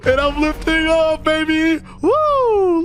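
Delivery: very high-pitched whooping; thrilled. Real, not acted. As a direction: whoop as you take off. Celebrating lift-off in the jet.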